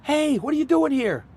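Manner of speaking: high astonished hello; surprised greeting. Retold or imitated. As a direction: caught off guard, then thrilled.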